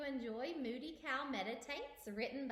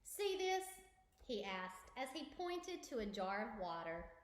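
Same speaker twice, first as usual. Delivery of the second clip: lively storybook narration; reading to children; 3 semitones higher, 5 dB louder than usual. Acted. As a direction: read it aloud for kids. Reads picture book aloud.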